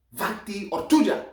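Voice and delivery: showing off a loud foreign insult; forceful Russian insult. Acted. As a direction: fling the curse out high and loud.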